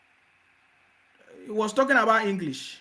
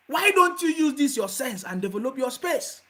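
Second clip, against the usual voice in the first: ordinary delivery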